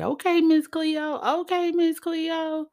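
high-pitched imitation of teasing people; mock teasing. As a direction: mimic a teaser in a high, stretched voice. Mimics people teasing her.

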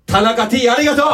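high, rising shout of gratitude; calling out thanks. Pretend. (叫ぶように感謝)

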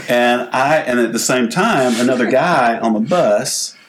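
usual speaking voice

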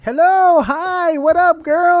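high, loud radio DJ greeting; hammed-up radio host hello. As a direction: put on a big high DJ voice for the phone. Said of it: "I gave her my, you know, D J voice".

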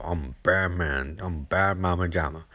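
ordinary talk

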